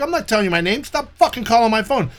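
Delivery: cursing out a phone scammer; retold angry shout. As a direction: tell the scammer off hard.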